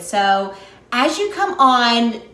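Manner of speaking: ordinary talk, nothing marked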